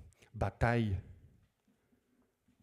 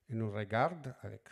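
ordinary talk

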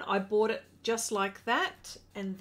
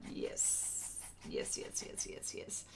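hushed, voiceless whisper of triumph; whispered yeses; 11 dB quieter, slower than usual. Real, not acted. Painter watching the brush work beautifully, whispering. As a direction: whisper it in private glee.

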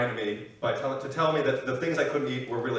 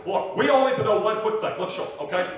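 loud, higher stump-speech impression; Bernie Sanders; 7 semitones higher, 4 dB louder than usual. Senator railing at the one percent, loud put-on voice. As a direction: punch the words like a rally speech. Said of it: "Bernie Sanders".

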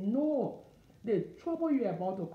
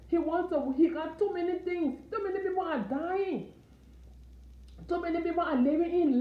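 preaching at full force; 5 semitones higher than usual. Acted. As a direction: rouse the congregation, urgent and pleading. Preaching builds to a climax.

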